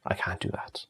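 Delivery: whispered refusal, breathy and low; muttering I can't do that almost to himself. Real, not acted. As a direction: breathe the words out under your breath.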